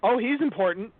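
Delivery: high and loud, announce someone important; mock awe. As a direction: voice leaping up in pretend awe. Voicing reaction to dragon's score.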